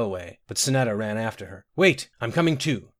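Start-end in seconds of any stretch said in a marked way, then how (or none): 1.8-2.9 high, urgent call; anxious calling out. A narrator's reading. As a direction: call after them in worry. Mother running after her child.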